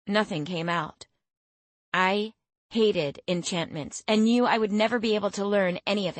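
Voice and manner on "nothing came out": usual speaking voice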